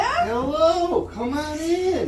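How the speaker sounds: high bright welcome; excited greeting. Real, not acted. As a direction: greet the guest with delight. Host welcomes guest.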